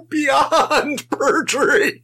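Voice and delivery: high, drawn-out delighted squeal; slow, high squeal of delight; gleeful agreement. Real, not acted. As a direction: stretch it out high with real glee. Gleeful agreement with co-host's perjury joke.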